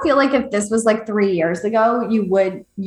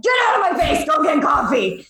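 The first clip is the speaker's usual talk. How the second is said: mock shout; 4 semitones higher than usual. Grumpy retort to early riser.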